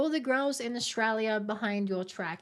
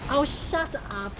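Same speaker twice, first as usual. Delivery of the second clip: mock scold; 4 semitones higher, slower than usual. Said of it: "Yue berate people".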